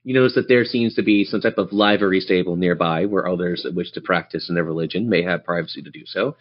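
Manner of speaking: normal talking voice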